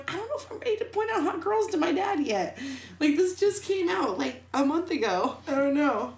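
high-pitched comic fluster; mock-overwhelmed retelling; flustered protest. Acted flustered thoughts about dad.

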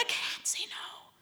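slow, high, whispered plea; slow whispered I can't say no; whispered helplessness. Retold or imitated. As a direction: whisper it, defeated and small. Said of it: "And I'm like".

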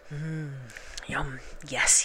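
taste it and enjoy it; savouring honey. Acted. Pooh licking honey alone in his larder, soft and pleased.

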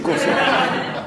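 laughing. Real, not acted. Laughs at own mishearing.

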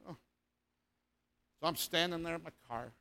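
ordinary talk, nothing marked

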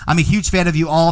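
ordinary talk, nothing marked

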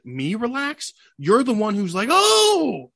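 indignant retort. Retold or imitated. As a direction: throw it back at her, mocking. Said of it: "I'm like".

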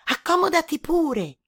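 high squeaky falling invitation; a polite little mouse host. A narrator's reading. Mouse welcoming the frog inside, squeaky. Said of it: "disse allora il topo".

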